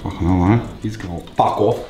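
ordinary delivery

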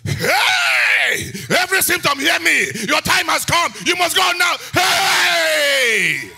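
very high, full-force commanding yell; shouting away symptoms. Shown as a demonstration. Preacher orders symptoms to leave, full-force shout. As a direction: bellow orders between long screamed heys. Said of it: "you shout away symptoms".

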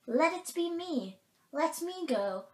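put-on high, loud young volunteer; eager fawn. A narrator's reading. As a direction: plead to go as a brave fawn. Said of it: "volunteered the fawn".

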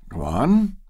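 desperate command. A narrator's reading. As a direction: push her to continue. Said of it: "Bashford commanded desperately".

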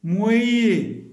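slow, loud, each word said alone; slow clear word list; word reading drill. Shown as a demonstration. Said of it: "Je lis bien les mots".

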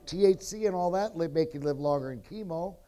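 normal talking voice

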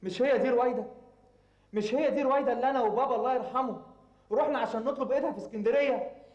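high, agitated protest to mother. Acted. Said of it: "وطي صوتك".

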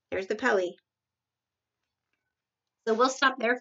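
ordinary delivery

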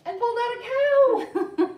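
high, loud burst; triumphant reveal. A narrator's reading. Librarian revealing the last animal, excited shout. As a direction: shout out the big surprise.

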